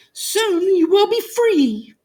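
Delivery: high, slow reassuring mouse; small mouse comforting the lion; tiny mouse. A narrator's reading. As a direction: soothe him in a squeaky voice. Mouse voiced in story.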